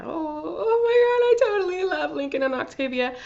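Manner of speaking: nearly crying over her favourite couple; overcome squeal. Real, not acted. Fan overcome while recapping Lincoln and Octavia, tearful squeal.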